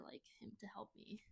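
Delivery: whispered, a little embarrassed; shy whisper. Real, not acted. Shy admission about dating.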